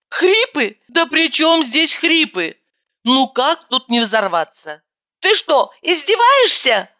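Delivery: high, exasperated yell. A narrator's reading. Narrator yells at roommate about her lungs, exasperated. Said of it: "заорала я".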